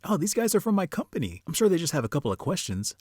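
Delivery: high-pitched, acted reassurance; mock-innocent, high neighbour voice; unsuspecting neighbour. Voices neighbour excusing the assassins.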